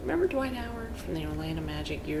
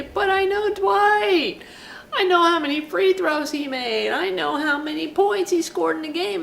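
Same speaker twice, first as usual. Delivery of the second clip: high, loud pleading insistence; insistent pleading fan; 11 semitones higher, 11 dB louder than usual. Retold or imitated. Fan pleading with the butler, loud and high. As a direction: protest that you know him, pitched up and loud.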